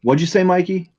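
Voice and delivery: tossed-off in-character question. Acted. Player asking the squid in character.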